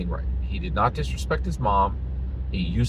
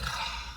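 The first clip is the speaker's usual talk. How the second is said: soft breathy sigh out; resigned sigh; 12 dB quieter than usual. Retold or imitated. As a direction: sigh like a worn-down mom. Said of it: "she sighs".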